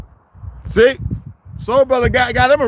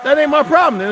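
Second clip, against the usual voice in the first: high, loud snapped retort; angry shout; 8 semitones higher, 4 dB louder than usual. Real, not acted. Telling a hater it's his problem, loud high shout. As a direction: throw it back at them, loud and high. Ranting at viewers on stream.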